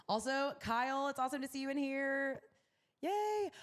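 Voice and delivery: high, sing-song shout-out; light, high-pitched cheer rising to a yay; delighted greeting. Real, not acted. Host spots old follower Kyle in the chat, delighted greeting.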